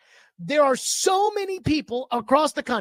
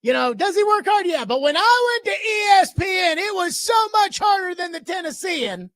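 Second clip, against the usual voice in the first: sneering send-up of a rival's bragging; mocking paraphrase; 6 semitones higher than usual. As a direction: parrot his boast back, half-shouting in scorn.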